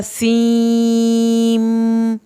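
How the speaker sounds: "سیم" with a long vowel closing into a held hummed m; shadda on meem. Shown as a demonstration. Teacher showing seen merging into meem, sustained "سیم". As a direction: draw out "سیم" and rest on the m. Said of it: "اس کو پڑھا جائے گا".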